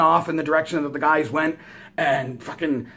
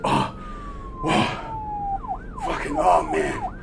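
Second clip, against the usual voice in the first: sigh of relief; 5 dB quieter, much slower than usual. Retold or imitated. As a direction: let the words out on relieved sighs. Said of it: "like, a sigh of relief".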